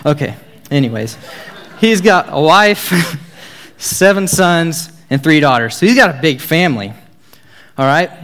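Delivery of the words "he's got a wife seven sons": laughing. Real, not acted. Laughing at his own PowerPoint joke.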